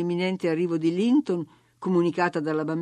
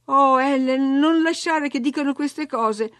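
lamento seccato, più alto e forte; infastidita; 6 semitones higher, 5 dB louder than usual. Acted. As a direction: protesta infastidita con Ellen. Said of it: "continuò lei infastidita". (Katy si lamenta con Ellen, infastidita)

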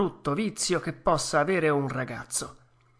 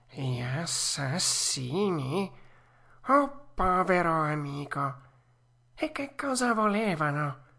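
a sly show of pity; mock concern; 2 semitones higher than usual. A narrator's reading. Fox pretending to worry about the puppet.

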